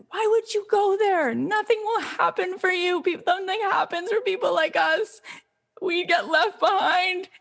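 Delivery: high, distraught mother's outcry; retold scream. Mother screaming at daughter leaving for L A, high retold outcry. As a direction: wail the warning, stretching the last words. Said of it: "screamed and said".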